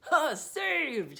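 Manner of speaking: relieved, loud exclamation; cried out. Acted. Sailor cries out in relief after she leaves. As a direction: burst out with relief. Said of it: "he cried".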